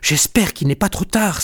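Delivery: a touch of worry; worried. A narrator's reading. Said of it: "s'inquiète Jean Chat".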